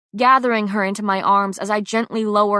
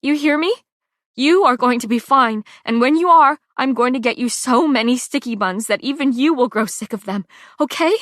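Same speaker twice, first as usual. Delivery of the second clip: higher, louder shaky pleading; shaking voice; 4 semitones higher, 6 dB louder than usual. A narrator's reading. Pei promises treats to her dying friend, voice shaking. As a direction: hold back sobs while promising. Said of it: "my voice shaking".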